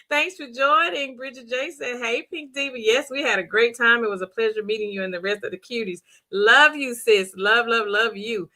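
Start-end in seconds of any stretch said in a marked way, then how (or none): none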